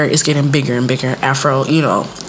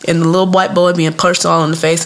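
normal talking voice

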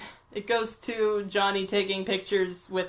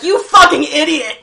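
loud mock yell; mock insult; 6 semitones higher, 13 dB louder than usual. Friend imitating the mean sister's loud yell. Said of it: "Susan's like".

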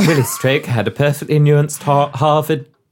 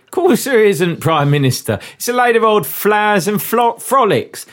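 hammy Cockney with rhyming slang; over-the-top stage Cockney; Cockney accent. Acted. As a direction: humour the boss in broad, badly faked Cockney. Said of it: "as nuanced as this Cockney accent".

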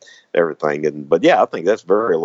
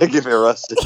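higher-pitched echo through laughter; laughing; 5 semitones higher than usual. Real, not acted. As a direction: repeat the joke while cracking up. Echoing partner's joke while laughing.

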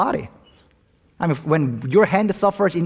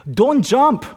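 urgent, higher-pitched cry to stop; acted alarm, pitched up; urgent plea; 8 semitones higher, 4 dB louder than usual. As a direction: call out to stop someone jumping. Joke pastor stops man jumping.

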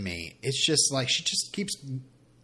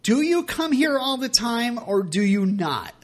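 exasperated question; 10 semitones higher, 4 dB louder, slower than usual. Retold or imitated. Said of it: "I'm like".

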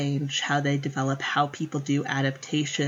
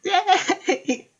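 much higher, laughing yay; mock cheer. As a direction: cheer ironically through a laugh.

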